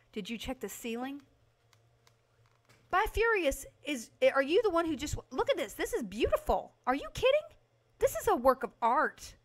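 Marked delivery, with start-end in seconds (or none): 2.9-9.4 high, raised-voice disbelief; incredulous, worked-up protest; indignant defence. Real, not acted. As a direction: defend your masterpiece against a critic. Indignantly defends paint job to viewer.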